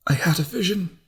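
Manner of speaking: a lightly voiced feverish visionary; entranced young monk. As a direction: sound lost inside a vision. Madman Derek speaks.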